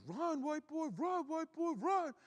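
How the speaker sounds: very high-pitched imitation of a teammate yelling; mock yelling. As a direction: squeal it as a frantic, repeated cheer. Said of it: "He just kept yelling at me".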